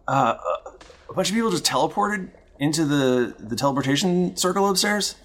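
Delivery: NPC voice. Acted. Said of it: "and he goes".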